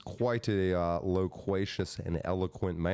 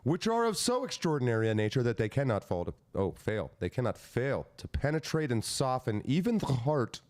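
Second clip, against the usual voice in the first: raised, sweeping oratorical pitch; proclamation reading; 4 semitones higher than usual. Acted. Reading Lincoln's wordy proclamation to co-hosts, declaiming. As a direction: declaim it with mock grandeur.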